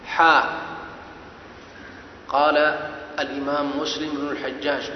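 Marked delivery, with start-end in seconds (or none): 0.1-0.6 حاء said as the letter name, a long open ḥāʼ; حاء with a clear throaty ḥ and long a. Shown as a demonstration. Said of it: "كيف تنطقها؟". (حاء بحاء حلقية ممدودة)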